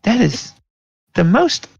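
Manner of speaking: high-pitched offended; high indignant voice. Acted. NPC indignant reply.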